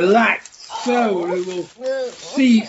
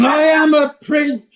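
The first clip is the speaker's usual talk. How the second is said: louder, quicker protest; indignant; 5 semitones higher, 6 dB louder, faster than usual. Acted. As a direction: insist on it, offended. Frog insists indignantly.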